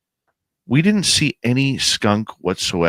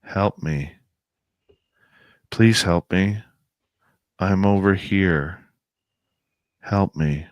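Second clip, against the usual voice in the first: imitating an eerie voice from the trees, monotone; monotone woman's voice. Uncanny woman calling from the woods, lightly voiced, monotone. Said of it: "a woman's voice, very monotone".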